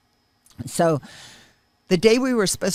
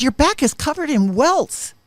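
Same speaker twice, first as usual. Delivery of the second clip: alarmed; 10 semitones higher, 7 dB louder than usual. Retold or imitated. Sees welts on sister's back.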